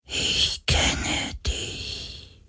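sehr leise, langsam gehaucht; geflüstert. Acted. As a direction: wie eine ferne Stimme im Kopf flüstern. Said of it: "flüsterte eine Stimme". (gehauchtes Geisterflüstern)

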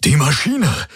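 high-pitched excited exclamation. A narrator's reading. Hawks wakes Kirai with news of the machine, excited. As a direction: burst out with the news, pitch leaping up. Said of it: "sagte er aufgeregt".

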